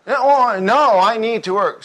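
high, loud hasty objection; protesting checkout clerk. Acted. Clerk defending themselves to a prying customer, high loud protest.

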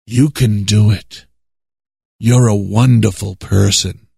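imitating a smooth TV preacher, voice dropped low; lightly voiced flattering preacher; mock preacher flattery. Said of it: "And he'd say".

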